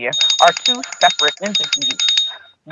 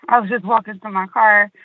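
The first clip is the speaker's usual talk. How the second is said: ordinary talk, nothing marked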